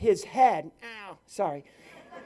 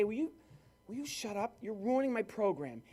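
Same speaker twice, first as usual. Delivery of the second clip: hushed, urgent aside to a heckler; panicked plea; 3 semitones higher, 9 dB quieter than usual. Retold or imitated. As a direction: plead under your breath, much softer. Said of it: "And so I panic. I'm sweating bullets, and I say".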